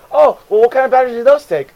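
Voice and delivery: high, loud, quick customer voice; oblivious customer. Retold or imitated. Customer asking cashier about batteries, high and oblivious. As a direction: chirp the question, missing the sarcasm.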